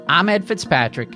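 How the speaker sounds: ordinary talk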